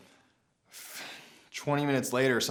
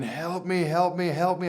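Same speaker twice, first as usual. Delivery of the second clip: panicked screaming for help; 7 semitones higher than usual. Retold or imitated. Injured snowboarder screaming to two skiers, in a high panicked voice. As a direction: beg for help as if bleeding out on the slope. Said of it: "and I'm screaming".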